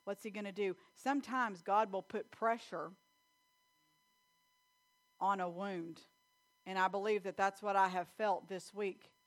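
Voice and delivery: normal talking voice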